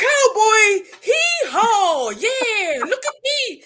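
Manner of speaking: loud, high cowboy yelling; fake American English. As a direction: shout nonsense like a cartoon cowboy. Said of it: "Pretend to talk English in the best way you can".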